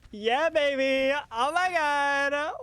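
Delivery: loud, high victory shout; excited cheer. Real, not acted. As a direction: celebrate the play at full voice. Celebrating a great play.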